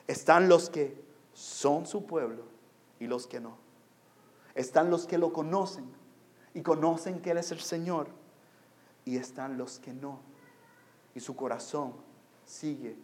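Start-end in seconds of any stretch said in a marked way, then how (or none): none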